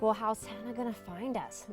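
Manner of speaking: ordinary talk, nothing marked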